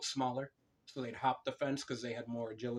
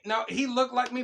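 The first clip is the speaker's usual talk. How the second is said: protesting; 10 semitones higher, 6 dB louder than usual. Real, not acted.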